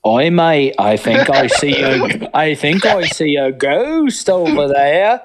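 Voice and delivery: slow, hammed-up Australian bloke; mock Australian accent. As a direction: drawl it like a cartoon Aussie spotting a ghost. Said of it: "Australian accent".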